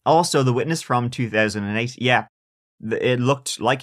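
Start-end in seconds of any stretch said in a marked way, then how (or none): none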